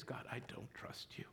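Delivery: soft whispered admission; whispered confession. Retold or imitated. Believer admitting distrust to God, whispered. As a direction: whisper the hidden truth. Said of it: "what I'm saying is".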